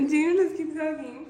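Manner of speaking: high giggling voice; laughing. Real, not acted. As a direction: laugh through the words. Daughter hugging new puppy.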